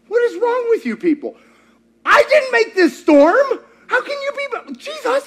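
Jesus scolding his frightened disciples in exasperation; exasperated Jesus. Retold or imitated.

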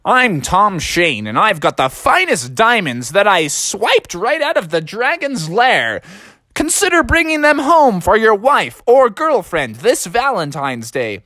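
swooping radio-ad pitchman; Tom Shane. Acted. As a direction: sell it like a local radio spot. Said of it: "in my next radio advertisement".

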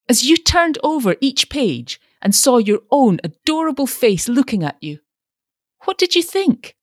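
high-pitched, impassioned pleading; ardent, exasperated lover's outburst; impetuous. A narrator's reading. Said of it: "exclaimed Mister Carroll impetuously".

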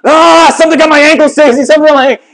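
loud high-pitched scream re-enacted; retold panicked yelling. Man waking his wife after a sting, panicked yelling. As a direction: scream it out like you've been attacked in bed. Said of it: "And I am yelling".

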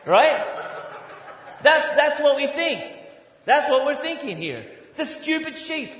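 high, loud, laughing scorn; loud laughing mockery. Pastor mocking the congregation's thinking, loud and laughing. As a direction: laugh at the stupid sheep. Continues the mock tirade laughing.

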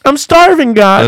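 loud, desperate prayer down a bad line; shouting into a phone. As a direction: yell your plea to God like the signal's bad.

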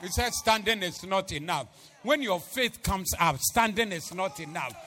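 loud, high shouted sermon; preaching at full force. Acted. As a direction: shout it to the whole church. Preaching climax on jumping faith.

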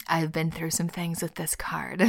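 hoarse, barely voiced, holding back tears; choked up. Real, not acted. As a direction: near tears, almost whispering. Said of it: "my sick voice and partly me getting choked up".

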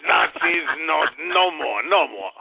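badly faked French accent, grumbling; put-on French accent. French caller dismisses the two Nazis, fake French-Austrian accent. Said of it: "his French impersonation sound much more like Arnold Schwarzenegger".

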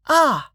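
high little "ah" of alarm; short high yelp from a small girl; startled child's cry. Acted. Child startled by officer, runs to hide.

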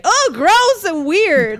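high, drawn-out disgusted exclamation; grossed-out Rick. Retold or imitated. Rick drops the living gun in disgust, grossed-out. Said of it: "Rick drops it saying".

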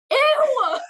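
high, loud mock disgust squeal. Grossed out by a girl crawling under stalls, loud high ew. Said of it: "I'm like".

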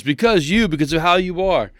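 retold exasperated accusation; accusing. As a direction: put the blame on the listener. Retells her blaming him on phone.

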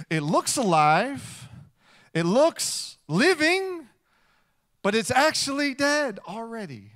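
slow, loud and high, every word stretched; a preacher's slow, swelling reveal; dramatic emphasis. Acted. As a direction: drive the point home to the congregation. Holding up the cut flower.

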